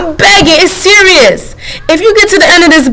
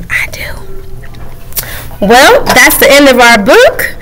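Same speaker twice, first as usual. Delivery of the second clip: ordinary delivery, nothing marked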